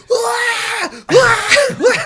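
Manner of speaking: very high, loud mock baby shriek; screaming baby. Imitating BB shrieking after the grenade blast. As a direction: shriek like a terrified infant. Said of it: "fucking B was like".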